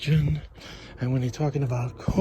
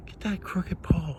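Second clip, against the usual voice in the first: normal talking voice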